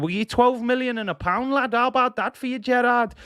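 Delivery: high-pitched, put-on Scouse; hammed-up Brookside Scouse, pitched well up; Scouse accent. Fan telling Gerrard to let Jezza go, Scouse accent. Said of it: "my Scouse accent".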